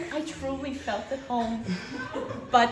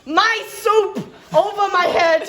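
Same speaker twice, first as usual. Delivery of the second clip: higher, louder cry of disbelief; outraged chef; 7 semitones higher, 6 dB louder than usual. Acted. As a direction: wail the indignity of it, loud and high. Outraged climax of chef's tragic monologue.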